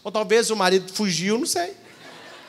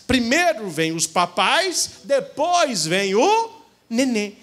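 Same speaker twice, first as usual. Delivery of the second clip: slow, deliberate; slower than usual. Shown as a demonstration. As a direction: spell out the order slowly. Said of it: "teaching the order slowly". (devagar, marcando cada palavra)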